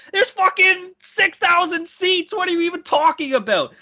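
high, incredulous rant; exasperated outburst. Real, not acted. Hockey podcaster scoffing at arena revenue claims, exasperated.

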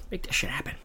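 breathy whispered repeat; hushed whisper for emphasis. Shown as a demonstration. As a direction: whisper it again like a secret aside.

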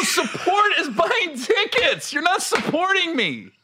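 high, slow, laughing emphasis; lecturing freeloading friends, high and emphatic; comic rant. Real, not acted.

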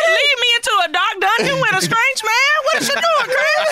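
high-pitched, hammed-up protest; mock indignation. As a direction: act out being affronted, voice way up. Acts out indignant inner reaction.

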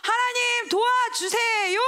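slow, high, loud plea; crying out to God. Shown as a demonstration. Believer crying out to God for help, loud and slow. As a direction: stretch it out as a desperate prayer.

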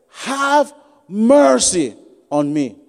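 very slow, pleading "Have mercy on me"; drawn-out plea. Acted. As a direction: stretch each word of the plea. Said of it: "He said".

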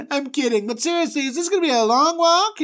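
high, whiny, faux-polite complaint; a complaining traveller nagging Moses, high and whiny; grousing Israelite. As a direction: needle the leader with fake cheer. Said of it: "people just started grousing".

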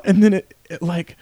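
normal talking voice

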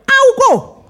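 loud, high burst of enthusiasm; excited eagerness. Acted. Preacher urging people toward the healing service, loud excited cry. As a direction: build to a high, eager cry on the last words.

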